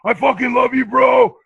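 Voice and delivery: high, loud adrenaline-fuelled yell of affection; re-enacted post-fight euphoria, high and loud; imitating elated shout.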